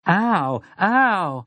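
high, drawn-out yelp of pain. A narrator's reading. Girl slapped awake by Jake, pained cry. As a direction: cry out from a sudden slap. Francine cries out when slapped.

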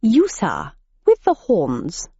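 plummy English gent, slow at the end; posh English gentleman. A narrator's reading. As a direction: address the beast like a club member. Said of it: "sounded like crumpets and tea".

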